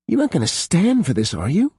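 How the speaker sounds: high, fast demand; fast, pressing question. A narrator's reading. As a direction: push her hard for an answer. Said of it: "Pippa demanded".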